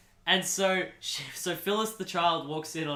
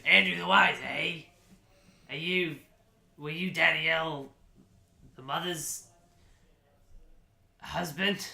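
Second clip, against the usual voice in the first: put-on loud start, then halting drunken questioning; 3 dB louder than usual. Drunk E T quizzing the dad, halting character voice. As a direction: stall between words as if sozzled. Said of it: "E T sounding really drunk at this point".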